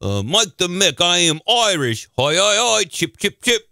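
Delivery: badly faked Irish accent; a hammed-up, muddled Irish brogue; fake Irish accent. Host becomes Mike the Mick on request, fake Irish accent. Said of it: "you could do an Irish accent".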